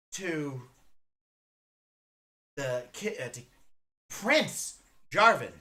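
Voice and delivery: ordinary talk, nothing marked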